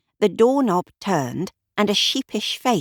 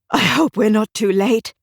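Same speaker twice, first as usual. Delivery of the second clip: a touch of breathlessness, higher and louder; panting; 4 semitones higher, 5 dB louder than usual. A narrator's reading. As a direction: say it as if out of breath from running. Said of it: "Amarnath panted".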